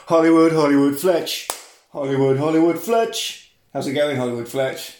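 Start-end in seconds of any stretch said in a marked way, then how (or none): 0.1-3.5 announcer voice. Acted. Introducing co-host with fanfare.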